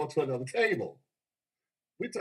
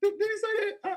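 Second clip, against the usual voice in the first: high squeaky did-he-say-that; mock shock; 4 dB louder than usual. Mocking a claim to the crowd, high shocked squeak. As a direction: act scandalized, voice jumping up high.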